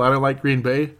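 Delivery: normal talking voice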